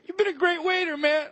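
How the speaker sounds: high mock-emotional gush; fake tearful thanks.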